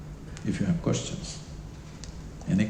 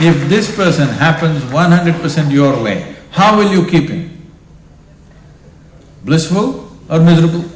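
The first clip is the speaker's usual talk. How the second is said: loud challenge; 3 semitones higher, 6 dB louder than usual. Real, not acted. Challenging the audience.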